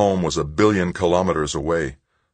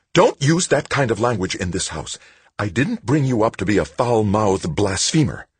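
a hint of stern mother, a touch higher; lightly voiced scolding mother; 6 semitones higher, 4 dB louder than usual. A narrator's reading. Mother rebukes son for swearing, lightly voiced stern. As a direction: scold him for his language.

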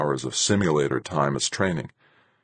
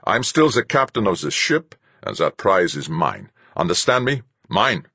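captain asserting command, mostly louder; possessive captain; 5 semitones higher, 4 dB louder than usual. A narrator's reading. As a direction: stake your claim as the captain.

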